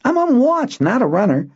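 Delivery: put-on high, loud boy's voice; indignant boy. Boy on watch refusing to run, indignant. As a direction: refuse with pride.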